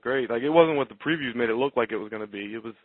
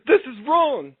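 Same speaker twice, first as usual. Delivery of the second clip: high-pitched imitation of a whimpering fighter; hammed-up, helpless whine; mock whimpering; 11 semitones higher, 4 dB louder, slower than usual. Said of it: "He kept whimpering the words".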